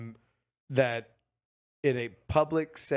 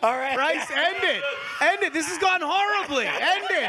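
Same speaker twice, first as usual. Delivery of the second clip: laughing; 12 semitones higher, 4 dB louder than usual. Real, not acted. Laughing, calling to stop the bit.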